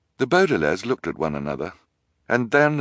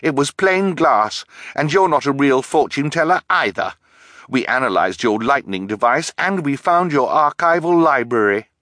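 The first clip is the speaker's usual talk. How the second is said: loud, high angry retort; angry girl's retort; angrily; 7 semitones higher, 6 dB louder than usual. A narrator's reading. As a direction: throw it back at her angrily. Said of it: "Violet translated angrily".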